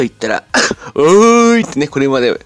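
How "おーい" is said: loud, high, drawn-out fan response; imitated biggest crowd shout. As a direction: shout back as the whole audience at once. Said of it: "もう一番大きい声が出ました".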